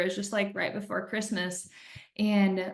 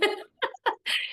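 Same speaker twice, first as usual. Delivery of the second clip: airy, voiceless giggle; a high breathy laugh; breathless laugh; 13 semitones higher than usual. Real, not acted. Remembering how she handed her husband the test, breathless laugh.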